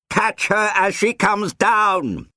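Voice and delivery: drawn-out, high-pitched officer's shout; shouted order. A narrator's reading. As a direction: shout it slowly over helicopter noise. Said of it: "he shouted".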